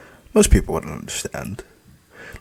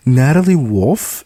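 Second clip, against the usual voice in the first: bright high cheerful greeting; cheery; 12 semitones higher, 6 dB louder, slower than usual. Acted. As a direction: sing it out sweetly. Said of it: "I cheered".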